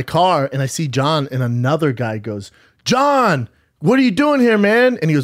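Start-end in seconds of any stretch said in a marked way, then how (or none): 2.9-5.0 high-pitched mock-surprised hail; imitated greeting. Another guy greeting John outside, imitated surprise. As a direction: call out to him high and animated.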